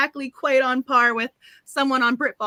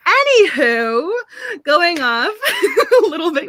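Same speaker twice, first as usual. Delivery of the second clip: laughing; 8 semitones higher, 6 dB louder, slower than usual. Real, not acted. Laughs at own rambling.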